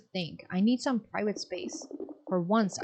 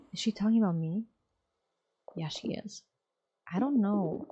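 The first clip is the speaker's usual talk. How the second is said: normal talking voice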